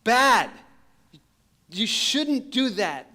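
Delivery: high, loud, slow scold. Retold or imitated. Missionary scolding the thief for stealing his phone. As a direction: draw out the reproach like a stern parent. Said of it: "I say".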